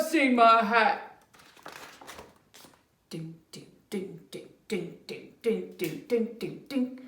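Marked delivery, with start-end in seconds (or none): 3.1-7.0 soft dinging. Acted. Bear's idea dawning.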